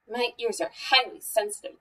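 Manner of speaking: plain reading voice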